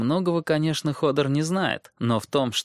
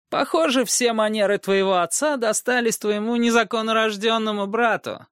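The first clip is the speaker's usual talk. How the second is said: sly dwarf voice; 11 semitones higher than usual. Acted. Tyrion taunts Robb.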